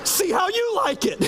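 very high, exasperated mock-mom outburst; high-pitched retaliatory nagging; mom snapping. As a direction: snap back, mimicking the nagging child. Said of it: "finally she snaps and she goes".

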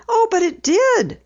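put-on high, earnest girl voice; earnest insisting. A narrator's reading. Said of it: "Penny earnestly insisted".

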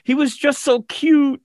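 very high-pitched mock gushing; put-on squeaky voice, making excuses; excuse-making sister. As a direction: imitate her cooing excuse way up high.

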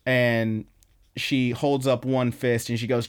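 ordinary delivery

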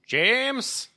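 high-pitched call for someone missing. Pretend. Player calls out for the vanished caravan, calling out. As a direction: call the name out, pitched well up. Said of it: "call out loudly".

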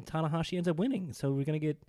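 ordinary talk

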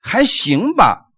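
slow, drawn-out imitation of hedging; noncommittal. Someone answers whether things are good, mock noncommittal. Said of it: "都叫做模棱两可的话".

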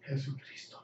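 breathy whispered Jesucristo; whispered aside. Retold or imitated. Guard reacting to a non-believer, whispered. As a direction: hiss it under your breath in disbelief. Guard muttering Jesus Christ.

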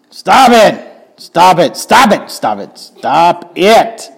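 mock parental yell at the kids; parent scolding kids. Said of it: "Anybody ever say that to your kids".

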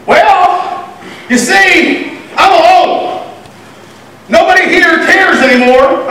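high-pitched, self-pitying whine; acting out a rehearsed complaint; whining. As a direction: whine it up high, poor lonely me. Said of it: "whining to God".

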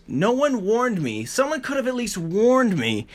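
high, slow, drawn-out grievance; aggrieved complaint. Real, not acted. Sufferer complaining nobody warned about the pain, aggrieved. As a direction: stretch each word in protest.

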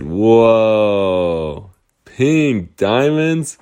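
drawn-out, slow wonder; amazed whoa. Acted. Girls spot pink diamonds, slow amazed wonder. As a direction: marvel at the sparkle.

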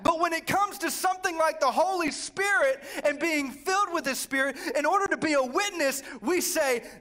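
high, forceful pulpit shout; fired-up preaching. Real, not acted. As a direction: preach it loud and driving, building to the contrast. Preacher contrasting excitement with refusal.

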